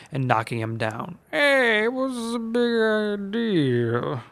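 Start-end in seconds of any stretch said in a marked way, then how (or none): 1.3-4.2 put-on higher, slow old man voice; protesting Uncle Seamus. A narrator's reading. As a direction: protest after being hit.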